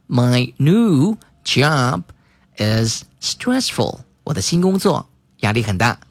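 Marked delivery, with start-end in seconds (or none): 0.1-4.0 very slow, word by word; slow reading. Shown as a demonstration. Teacher slowly repeats the example for the class. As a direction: read it slowly for students to repeat. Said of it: "repeats slowly for class".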